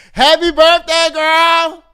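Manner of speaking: high, loud birthday shout; birthday cheer. Real, not acted. Host cheering Taraji, high and loud. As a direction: belt it out to the star.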